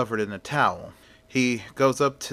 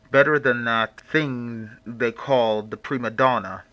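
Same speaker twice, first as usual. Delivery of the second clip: mysterious stranger voice. Acted. Hidden figure confronts the critic.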